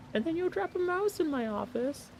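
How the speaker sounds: ordinary delivery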